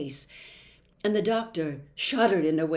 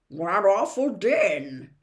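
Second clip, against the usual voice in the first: slow, louder character voice; Tock's answer; 5 dB louder, slower than usual. A narrator's reading. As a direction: draw out the complaint with weight. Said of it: "answered Tock".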